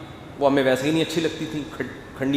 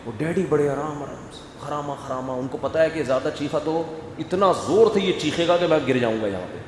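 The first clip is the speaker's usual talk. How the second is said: ordinary delivery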